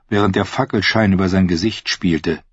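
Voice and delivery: plain reading voice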